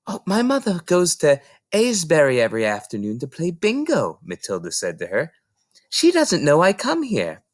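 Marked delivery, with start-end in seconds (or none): 0.1-4.2 put-on lightly raised little girl voice; a small girl answering the librarian; Matilda, young girl. Little Matilda explaining to Mrs Phelps, young girl voice. 5.9-7.4 put-on lightly raised little girl voice; a small girl answering the librarian; Matilda, young girl. Little Matilda explaining to Mrs Phelps, young girl voice.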